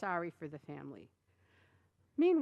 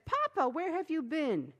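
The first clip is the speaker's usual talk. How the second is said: put-on child's voice; 8 semitones higher, 5 dB louder than usual. A narrator's reading. Children greet father.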